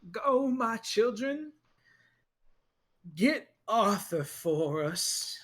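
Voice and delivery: slow, solemn order; priest commanding. Acted. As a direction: send the flock after Arthur. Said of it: "He goes".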